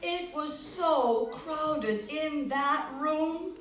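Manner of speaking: loud, animated storybook voice; story narration. A narrator's reading. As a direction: build the crowded chaos. Storyteller building the chaos.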